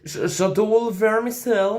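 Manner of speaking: French said with a slow English accent; j'adore le vermicelle in a slow English accent. Shown as a demonstration. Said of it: "Dis-le avec l'accent anglais".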